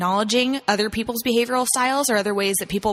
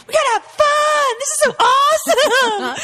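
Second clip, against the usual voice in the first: very high, loud, slow squealing enthusiasm; mock excited squeal; 5 dB louder, slower than usual. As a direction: shriek it like an overexcited cheerleader. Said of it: "I'm just like".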